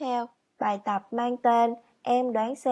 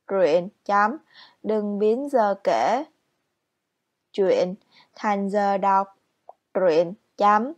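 slow, louder dictation, stressing each word; dictating; 4 dB louder, slower than usual. Shown as a demonstration. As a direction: dictate the answers clearly with pauses. Teacher reads the answers aloud.

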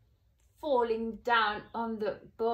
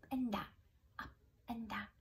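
whispered fade; 9 dB quieter, much faster than usual. A narrator's reading. Fading narration.

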